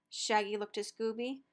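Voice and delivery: ordinary delivery, nothing marked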